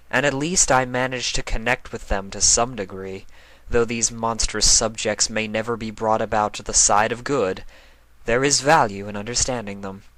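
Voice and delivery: rueful self-reproach in a lightly put-on voice; reminding herself. A narrator's reading. Luna reminding herself where the blame lies, lightly voiced. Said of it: "she reminded herself".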